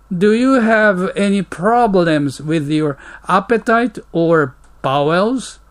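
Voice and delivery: slow, careful English read for learners; slow model reading. Shown as a demonstration. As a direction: read each word out slowly and clearly. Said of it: "English model dialogue read slowly". (ゆっくり丁寧な英語の模範読み)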